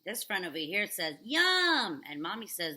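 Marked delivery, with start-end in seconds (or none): 1.2-2.0 high, loud happy yum; delighted yum. Acted. Friend tasting cocoa, loud delighted yum. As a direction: relish it like a kid.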